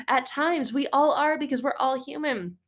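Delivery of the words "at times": ordinary talk, nothing marked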